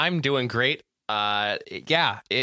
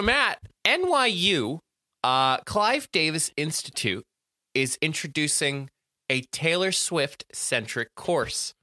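news announcement; 4 semitones higher, slower than usual. Acted.

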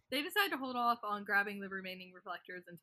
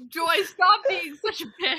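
much louder, higher mock scolding; laughing mock scold; 7 semitones higher, 14 dB louder than usual. Imagining Mara scolding Joy, loud laughing scold. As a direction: snap it out through laughter. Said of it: "Mara would be like".

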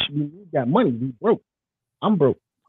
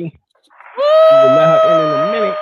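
high, slow, hyped announcement; drawn-out hype announcer; 8 dB louder, slower than usual. Acted. Host announcing guest arrival.

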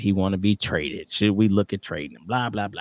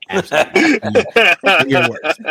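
laughing agreement; 9 semitones higher, 8 dB louder, slower than usual. Real, not acted.

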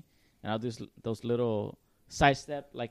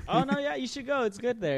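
much higher, breezy mock agreement; fake cheerful reply; 10 semitones higher than usual. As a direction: answer brightly off-topic. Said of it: "I'll be like".

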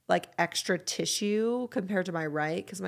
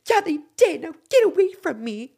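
loud, much higher mock-furious snap; mad; 11 semitones higher, 11 dB louder than usual. Retelling snapping at husband David, hammed-up loud anger. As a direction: blast it like an offended wife. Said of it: "I was so mad".

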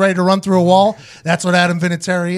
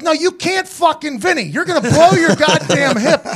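higher, laughing alarm; alarmed coaches; 8 semitones higher than usual. Retold or imitated. Coaches warning the kicker about his hip, high and alarmed. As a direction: stop him from kicking, half laughing. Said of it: "They're like".

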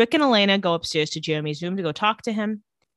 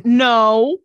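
a loud, sharp refusal; a flat-out loud no; 9 dB louder than usual. Retold or imitated. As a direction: shut it down hard. Damon refuses Elena's deal.

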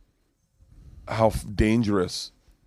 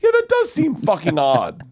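high, slow, comic outburst; dawning realization; 9 semitones higher, 4 dB louder than usual. Retold or imitated. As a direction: draw it out in mock amazement. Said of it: "all of a sudden the realization".

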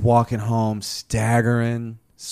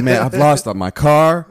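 retold quote; 5 semitones higher, 8 dB louder, slower than usual. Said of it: "I don't know why I said it".

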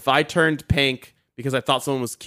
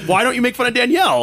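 high mock complaint; 9 semitones higher than usual. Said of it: "I'm like".

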